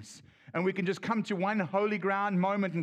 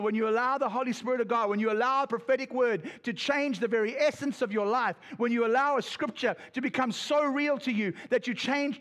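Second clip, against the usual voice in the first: full-force preaching; 4 semitones higher than usual. Real, not acted. Preacher urging the congregation, building, full force. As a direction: drive it home to the congregation.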